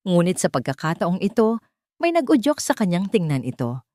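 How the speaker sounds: plain reading voice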